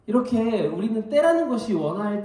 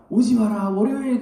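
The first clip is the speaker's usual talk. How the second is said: loud, dramatic ad line; ad tagline; 6 semitones higher, 8 dB louder than usual. Retold or imitated. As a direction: deliver it like a hotel ad slogan. Quoting actor's ad line.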